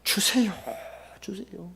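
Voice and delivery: breathy, half-whispered begging prayer; tearful pleading. Acted. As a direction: act out a spent, weeping plea. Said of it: "대부분 이렇게 기도할 겁니다".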